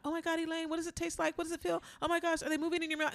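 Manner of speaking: high breathless chatter; excited host. Retold or imitated. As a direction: gush fast like an excited host. Host chattering while she chews.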